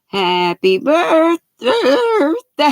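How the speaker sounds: sung high and slow, breaking up; voice cracking. Real, not acted. Singer reaching for the high birthday note, voice cracking. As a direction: let the singing voice crack on the climb. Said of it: "Cracking of the voice when it goes up".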